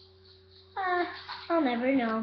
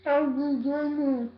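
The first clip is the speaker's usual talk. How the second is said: cheeks stuffed full; slower than usual. Real, not acted. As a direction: mouth crammed with marshmallows. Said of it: "So I got nine".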